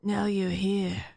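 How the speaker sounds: slow, put-on low male voice; low character voice. Mocking the male lead's reply, slow and low. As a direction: rumble it out slowly. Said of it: "It's literally like that".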